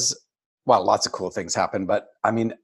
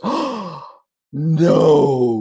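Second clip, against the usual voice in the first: oh jumps up high, then no lands louder; surprised delight; 5 semitones higher, 6 dB louder than usual. Retold or imitated. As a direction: act out her stunned surprise.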